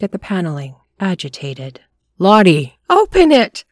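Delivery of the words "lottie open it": loud, much higher frantic cry; frantic shout. A narrator's reading. Couple frantic at the stuck witch door, shouting. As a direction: yell in panic.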